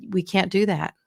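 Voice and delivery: usual speaking voice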